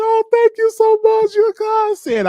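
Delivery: high, loud mock gushing; over-the-top teary gratitude; grateful crying woman. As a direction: gush your thanks like a hammed-up weeping lady. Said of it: "then she tried to hug me".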